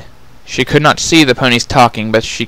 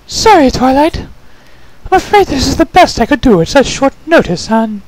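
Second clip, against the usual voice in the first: high, refined and genteel lady; Rarity's voice. Acted. Changeling prisoner calls out to Twilight, refined and high. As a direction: coo it sweetly, much higher.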